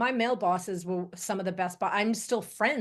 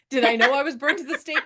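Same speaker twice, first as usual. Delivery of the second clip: laughing; 6 semitones higher, 6 dB louder, faster than usual. Real, not acted. Laughing rhetorical.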